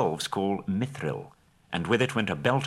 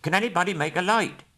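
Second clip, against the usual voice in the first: urgent call; 10 semitones higher, faster than usual. Acted. Bilbo calls in darkness.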